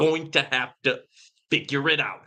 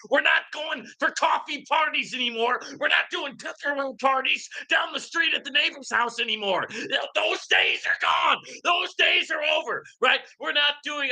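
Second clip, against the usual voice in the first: heated rant; 9 semitones higher than usual. Real, not acted. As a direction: hammer it home like a fed-up ranter. Heated rant against old ways.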